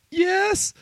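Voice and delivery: high, squeaky mock kid voice; little kid. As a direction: answer as an eager child, far up in pitch. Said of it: "And he's like".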